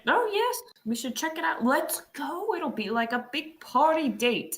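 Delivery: high, eager excitement; bubbly and thrilled; excited. Real, not acted. Excited about zoo trip.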